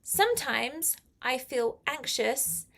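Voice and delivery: ordinary talk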